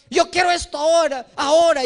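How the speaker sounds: put-on whiny child. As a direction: whine for the toy, stretching ahora. Child in market wants toy.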